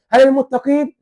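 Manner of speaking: questioning emphasis. Real, not acted. Rhetorical quiz question to audience.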